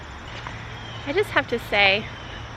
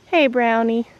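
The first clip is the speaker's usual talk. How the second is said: normal talking voice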